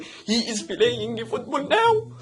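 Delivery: high, slow mock Egyptian English; Egyptian-accented English, hard g on -ing, last word stretched; overdone Egyptian accent. Shown as a demonstration. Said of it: "اديناهم حقهم جامد، جامد جدًا". (لهجة مصرية مبالغ فيها)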